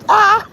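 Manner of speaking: sharp high yelp; a sudden high cry of alarm; startled yelp. Real, not acted.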